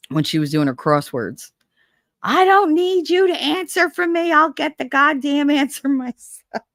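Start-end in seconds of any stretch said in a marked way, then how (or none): 2.2-6.6 high-pitched mock indignation, breaking into laughter; indignant grandma. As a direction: imitate a cranky grandmother refusing help. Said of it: "the fact that she used to".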